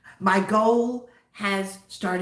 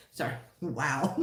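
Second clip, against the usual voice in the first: ordinary talk, nothing marked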